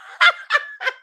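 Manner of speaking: high burst of laughter; real high-pitched cackling laugh. Real, not acted. Laughing at being muted.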